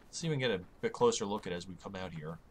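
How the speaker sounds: ordinary delivery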